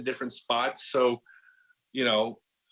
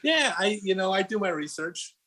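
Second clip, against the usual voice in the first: higher, playful mock-smug reply; amused; 7 semitones higher than usual. Real, not acted. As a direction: tease back with a grin in the voice. Joking back, amused.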